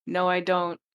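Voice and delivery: insistent loud denial; loud protest. Real, not acted. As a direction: shout down the teasing. Denying a tease, getting louder.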